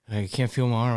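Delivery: mock panic. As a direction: act out a player mildly freaking out. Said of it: "freaking out a little bit".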